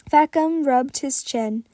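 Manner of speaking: ordinary delivery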